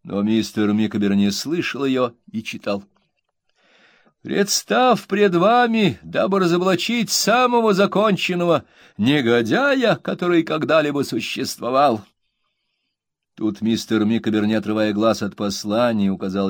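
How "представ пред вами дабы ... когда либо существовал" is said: pompous reading. A narrator's reading. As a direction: declaim it grandly. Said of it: "напыщенным видом".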